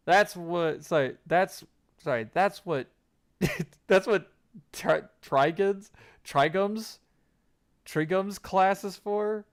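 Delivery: stammering, embarrassed; flustered. Acted. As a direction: fumble for an excuse.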